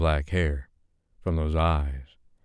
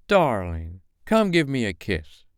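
higher, theatrical diva calling; grand dame voice; 6 semitones higher than usual. A narrator's reading. Madam M beckons Dave, theatrical dame voice. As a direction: purr it out like a statuesque dame.

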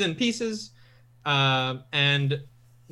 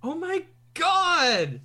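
high, slow, drawn-out astonishment; amazed fan; 11 semitones higher, much slower than usual. Real, not acted. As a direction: stretch it out in starstruck amazement. Fan learns friend voices favourite characters.

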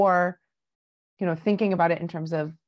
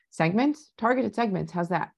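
ordinary delivery

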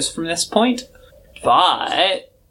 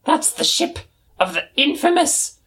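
much higher, knowing dramatic squeak; Old Smoky. Acted. Old Smoky spots the rival ship, high dramatic voice. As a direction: savour the ominous name.

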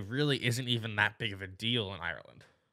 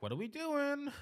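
high mom voice; 9 dB quieter than usual. Retold or imitated. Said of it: "She's like".

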